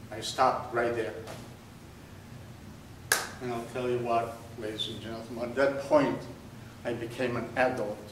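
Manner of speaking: usual speaking voice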